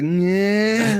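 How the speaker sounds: high engine whine of zooming cars; imitated race-car noise. Fighter mimics track cars keeping him awake, high whining engine noise. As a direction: mimic a loud car whizzing by, high-pitched. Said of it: "dude, they're loud. They're like".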